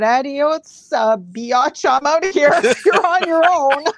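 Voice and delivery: high, loud mock panic; hammy scared guy bailing; fleeing psychic quote. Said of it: "I guess he said".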